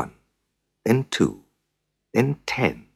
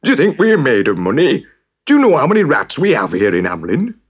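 outraged mayor; 7 semitones higher, 5 dB louder than usual. Acted.

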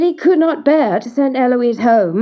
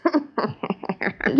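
softer wicked dwarf laugh; gloating cackle; 6 dB quieter than usual. Acted. Dwarf waits for his prize, gloating cackle. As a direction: cackle to yourself in triumph.

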